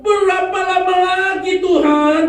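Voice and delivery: loud, high, slow anguished plea; loud prophet's slow lament; crying out to God. Retold or imitated. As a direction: cry out to God in despair. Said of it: "dalam penglihatan Nabi Habakuk di sana dikatakan".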